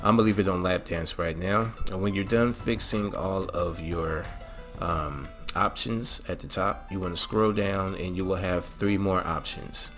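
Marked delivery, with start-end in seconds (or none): none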